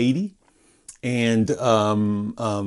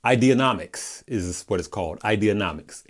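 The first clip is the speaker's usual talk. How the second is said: ordinary talk, nothing marked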